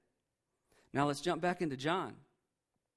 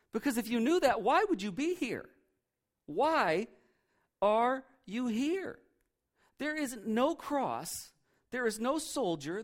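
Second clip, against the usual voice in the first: exasperated; 5 semitones higher, slower than usual. Retold or imitated. Angels scolding the mourning women, exasperated. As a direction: demand to know why they came.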